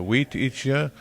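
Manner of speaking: normal talking voice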